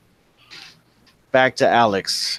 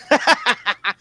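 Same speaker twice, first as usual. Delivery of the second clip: high, gleeful cackle; acted belly laugh; 12 semitones higher, 3 dB louder than usual. Husband gloats over the ruined plants, high cackling laugh. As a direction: laugh your ass off in character. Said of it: "starts laughing his ass off".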